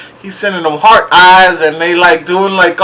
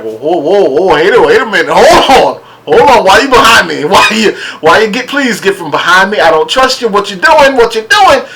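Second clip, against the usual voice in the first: panicked; 4 semitones higher than usual. Retold or imitated. Imagining himself on the show with a man behind him, alarmed. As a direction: back away alarmed.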